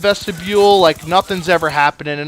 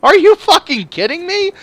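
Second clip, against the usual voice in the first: high-pitched, indignant disbelief; incredulous outrage; 10 semitones higher than usual. Retold or imitated. Outraged reaction to offender rule.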